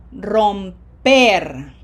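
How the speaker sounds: Romper with long vowels, second syllable hit hard; loud teacher demo of Romper, stressed at the end; long vowels, final stress. Shown as a demonstration. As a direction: stretch Romper and punch its last syllable. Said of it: "Long vowels and emphasis on the red syllable".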